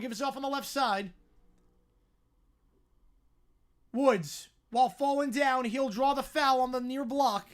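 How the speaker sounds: brisk, projected basketball commentary; live play-by-play. Real, not acted. Calling a basketball possession for listeners, live play-by-play. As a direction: follow the ball down the court, punching key words.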